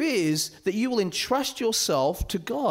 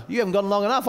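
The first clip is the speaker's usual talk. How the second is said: ordinary delivery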